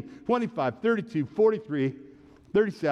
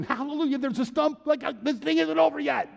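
high-pitched hallelujah cheer; mock jubilation; 10 semitones higher than usual. Believer rejoicing that the stump is left, jubilant. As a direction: cry it out like an elated believer.

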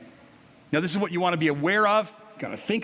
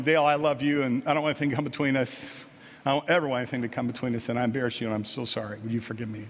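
tender apology; 6 semitones lower, faster than usual. Retold or imitated. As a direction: gently ask forgiveness, voice sinking low. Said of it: "and I said to him".

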